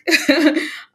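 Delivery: short high laugh; bright laugh. Real, not acted. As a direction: burst out laughing. Laughing at her own idea.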